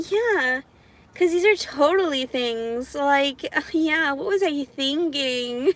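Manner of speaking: high, sarcastic put-on pleasantness; condescending voice. Speaker mocking unfair traffic rules, condescending. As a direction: pitch it up and feign cheerful self-reproach. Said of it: "that's the kind of condescending voice I do to men".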